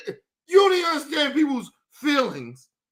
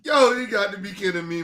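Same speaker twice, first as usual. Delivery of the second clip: usual speaking voice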